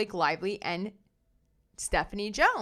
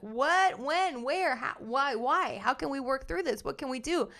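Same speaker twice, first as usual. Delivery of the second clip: higher, rapid-fire string of questions; mock concerned questioning; 4 semitones higher than usual. Imagining the boss's reply to a complaint, mock concerned questioning. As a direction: imitate a boss lightly startled into questions.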